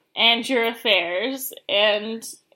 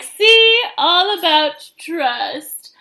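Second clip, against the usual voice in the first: 1 smug rancher; 5 semitones higher, 7 dB louder, much slower than usual. Retold or imitated. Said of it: "he's like".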